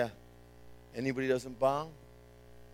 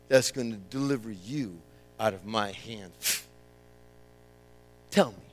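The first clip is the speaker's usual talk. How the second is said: lower, fast stern king warning; the king threatening the three before the world; 6 semitones lower than usual. Acted. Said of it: "Nebuchadnezzar said to them".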